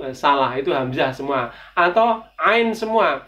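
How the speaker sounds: normal talking voice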